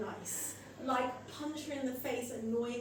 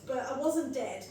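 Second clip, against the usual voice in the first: usual speaking voice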